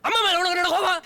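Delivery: high, loud reproach. Acted. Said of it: "அமைதியா பேசு".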